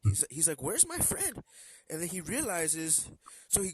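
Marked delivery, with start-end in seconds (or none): 0.6-1.4 sudden high cry of where's my friend; alarmed camper. Acted. Camper realizes friend is missing, high alarmed call. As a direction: pitch it way up in alarm.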